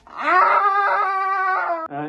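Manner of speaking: long, high, loud scream; sudden scream. Pretend. Presenter breaks off the thanks, long high scream. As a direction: burst into a held scream mid-thought.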